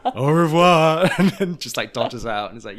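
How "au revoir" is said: put-on very slow, louder, grand drunk goodbye; drunk diva farewell. Luann exiting drunk in her feather stole, slow grand mock farewell. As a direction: sweep out like a tipsy diva.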